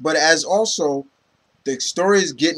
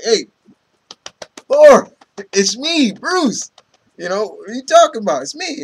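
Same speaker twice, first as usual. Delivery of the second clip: put-on high, loud insisting call to an old friend; pleading Bruce; 6 semitones higher, 6 dB louder than usual. Bruce begging Thor to recognize him, high and loud. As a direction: make him believe it's you, pitch up. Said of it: "Bruce is like".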